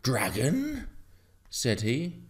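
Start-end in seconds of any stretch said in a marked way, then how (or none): 0.0-0.9 incredulous, higher-pitched question; taken aback by the news; startled farmer. A narrator's reading. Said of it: "The farmer choked in his beer".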